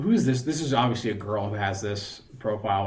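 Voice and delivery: usual speaking voice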